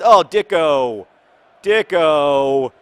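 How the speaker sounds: groan-cheer at the near miss; excited shout. Real, not acted. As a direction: fan shouting a player's name. Near goal by favourite player.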